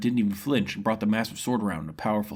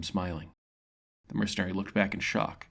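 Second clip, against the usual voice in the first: plain reading voice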